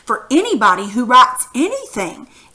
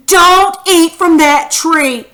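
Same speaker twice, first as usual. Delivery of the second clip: loud, raised, drawn-out order; strong-feeling command; 6 semitones higher, 8 dB louder, slower than usual. Shown as a demonstration. Teacher modelling strong feelings for pupils, loud command. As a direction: call it out slowly with force. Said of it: "It's strong feelings".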